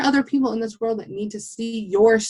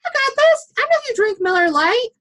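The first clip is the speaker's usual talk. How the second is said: excited gushing; 11 semitones higher than usual. Retold or imitated. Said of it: "I was like".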